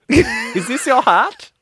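put-on high, loud, slow German accent with laughter; mock German. Laughing and copying the friend's German accent. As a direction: drag out "Is this your hat?" in a silly German accent.

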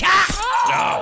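very high, loud mock "Ah!" scream; imitated stab yell. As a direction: yell it like the killer striking. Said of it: "and then he just went".

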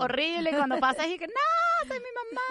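high mock-horrified shriek; mock horror. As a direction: squeal in mock horror at turning into mom. Horrified at becoming her mother.

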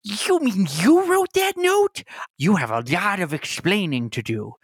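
a shocked woman confronting her partner; accusing NPC voice. Acted. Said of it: "you hear from behind this window a voice go".

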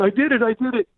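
high-pitched excited chant; acted elation, pitched well up; echoed celebration. Firewalker joining the girl's cheer, high excited chant.

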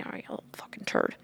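put-on hissed under the breath at the kid; a furious aside, breathed not voiced; angry whisper. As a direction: whisper the insult so only he hears. Stink eye at a rough kid.

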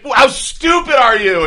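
slow, high-pitched scornful shout; mock berating yell. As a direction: stretch the insult out loudly. Rich prankster berates the girls.

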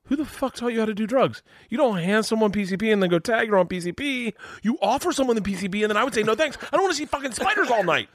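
high, indignant retold tirade; a young comic scolding a celebrity in a hammed-up high voice; mock outraged rant. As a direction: lecture him on drug etiquette in a squeaky huff. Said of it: "And I was like".

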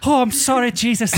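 high, hammy put-on Irish accent; mock apologetic Irish prayer voice. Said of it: "That's more Southern Irish".

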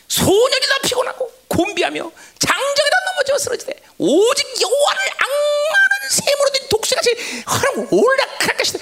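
high, sustained preaching cry; impassioned preacher reciting Scripture; fervent scripture recitation. Acted. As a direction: proclaim the verse at full pitch. Recites Isaiah verse with fervour.

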